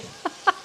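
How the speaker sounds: a short unvoiced giggle left over; breathy giggle. Real, not acted. Still giggling while trying to talk to viewers. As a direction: giggle under your breath. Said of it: "I can't even say it with a straight face".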